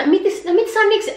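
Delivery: frantic call not to open the doors; retold yell. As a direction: shout it up high so the driver hears. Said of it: "Εκεί ουρλιάζουμε".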